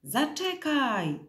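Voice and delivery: high, long-drawn call that falls away; shouted call. A narrator's reading. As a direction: call out after someone far off. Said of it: "krzyknął".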